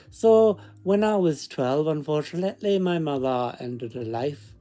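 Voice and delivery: storytelling in a put-on Scottish accent; fake Scottish accent. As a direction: read it aloud with a mock Scottish lilt. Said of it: "Let's continue with the accent".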